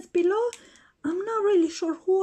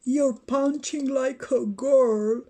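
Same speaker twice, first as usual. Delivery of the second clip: much lower, gruff tough-guy boasting; toy Batman voice; 5 semitones lower than usual. Pretend. Batman taunting the other toys, deep boastful voice. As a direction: play the bully hero claiming his spot.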